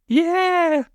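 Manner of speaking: very high squealing goblin yell; goblin scream. A narrator's reading. Goblin cheering the plan, a very high scream. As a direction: shriek it like a thrilled goblin. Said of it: "screamed the goblin".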